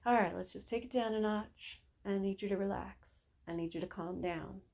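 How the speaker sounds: soft, lowering voice; quiet, soothing reassurance; calming. Acted. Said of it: "the more we can be like".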